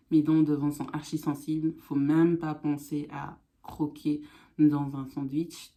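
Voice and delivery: usual speaking voice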